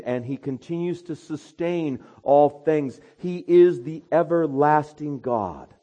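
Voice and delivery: full-force sermon delivery; preach. Acted. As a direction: preach it out to the congregation.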